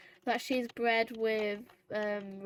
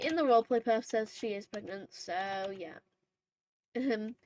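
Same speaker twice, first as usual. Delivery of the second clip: usual speaking voice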